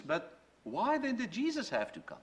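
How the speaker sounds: ordinary talk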